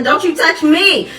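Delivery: put-on high, slow child's protest; defiant little girl. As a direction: stand up like a little kid. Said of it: "She said".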